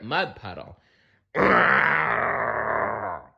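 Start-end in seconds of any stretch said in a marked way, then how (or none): 1.3-3.3 short rough snarl; dog snarl. Acted. The dog snarls coming through the gate. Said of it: "snarled the dog".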